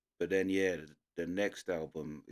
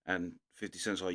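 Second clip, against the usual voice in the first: usual speaking voice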